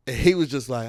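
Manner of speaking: ordinary talk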